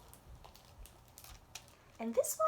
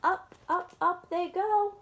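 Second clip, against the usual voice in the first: high, loud sing-song; loud, high storybook cheer; rising excitement; 7 semitones higher, 6 dB louder than usual. A narrator's reading. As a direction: lift each up higher like it floats away. Reading cheerful climb.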